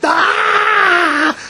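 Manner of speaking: high, loud shocked cry; startled yell. A narrator's reading. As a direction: jump back in alarm. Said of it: "Her father turned around and said".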